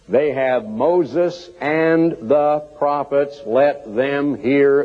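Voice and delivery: very slow, weighty reading; solemn scripture. Acted. Preacher quoting God's word to the church, slow and solemn. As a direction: draw each word out slowly. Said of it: "solemn scripture reading".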